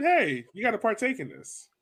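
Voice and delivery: usual speaking voice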